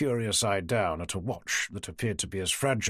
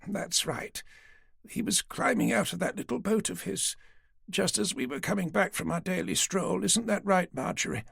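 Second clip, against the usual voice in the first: put-on higher, frail old woman's voice; an old lady patiently humouring the police; elderly lady; 7 semitones higher, 3 dB quieter than usual. Old woman Dorothy gently agreeing to talk, higher old voice. Aged Dorothy recounts sighting.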